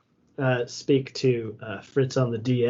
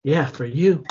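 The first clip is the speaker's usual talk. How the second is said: sarcastic. Acted. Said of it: "I'm being sarcastic".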